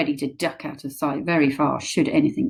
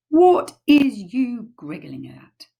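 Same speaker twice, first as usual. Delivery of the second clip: slightly nettled; 6 semitones higher, 4 dB louder, slower than usual. Acted. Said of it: "slightly nettled".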